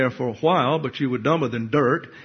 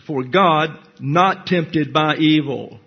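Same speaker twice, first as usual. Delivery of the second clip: slow, weighty reading of God not tempted by evil; emphatic; 3 dB louder, slower than usual. Shown as a demonstration. Preacher stressing the verse to the congregation, emphatic and slow. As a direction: lean on "God" and slow every word down. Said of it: "puts great emphasis on what's being said here".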